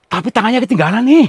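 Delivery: high, loud startled discovery. Real, not acted. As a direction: blurt it out in shock. Finds the ghost's left-behind hand. (kaget, suara tinggi dan keras)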